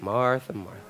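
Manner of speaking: very soft, low repeated name; gentle calming. Shown as a demonstration. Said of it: "So go ahead and say that".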